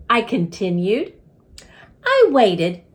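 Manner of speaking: usual speaking voice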